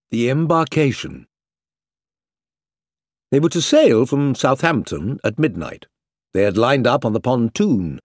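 ordinary delivery

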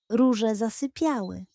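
ordinary delivery

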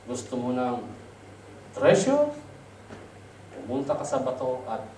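a quiet, low heavenly voice in a dream; voice from the clouds. Acted. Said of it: "Ang sabi ng boses niya". (mababa at mahinang tinig mula sa ulap)

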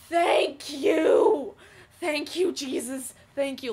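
loud, crying out thank you; relieved cry of thanks. Retold or imitated. Escaping the gory film, relieved loud sobbing thanks. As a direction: sob it out with huge relief. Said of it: "in my head, I'm like".

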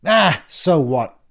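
loud, slow scornful snort; snorting dismissal. A narrator's reading. Ian shrugs off the letter, snorting scornfully. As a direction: scoff it out, dragging the words. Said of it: "snorted and said".

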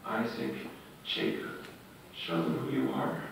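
a quiet, reverent plea; earnest prayer. Retold or imitated. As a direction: pray low and humbly. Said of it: "gets on his face and says".

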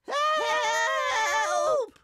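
very high, long scream; cry for help. A narrator's reading. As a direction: scream it while being dragged away. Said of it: "they cried".

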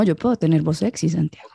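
low, softening seductive delivery; sexy voice. Shown as a demonstration. Woman proving to Santiago she can have a sexy voice, low and seductive. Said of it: "Ok, serio".

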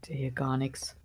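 whispering. Real, not acted. Whispering in the dark.

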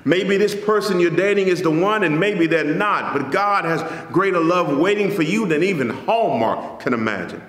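ordinary talk, nothing marked

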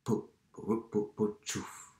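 quiet, slow tractor chugging; hushed imitation of a distant motor; soft rhythmic engine purr. A narrator's reading. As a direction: putter softly as if far away. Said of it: "un ronroneo suave y rítmico".

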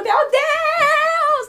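very high, loud, held-out shriek; screaming crowd, imitated. Everyone at the cinema screaming, imitated crowd shriek. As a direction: shriek it long like the whole crowd. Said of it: "tava todo mundo numa gritaria".

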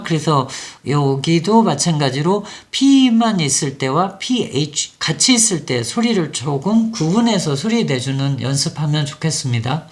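ordinary talk

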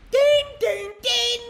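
high-pitched sung-out dings; imitated dinging. Real, not acted. Player imitating a game's theme, high dinging. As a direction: ring it out high like a chime. Voicing a sound after music request.

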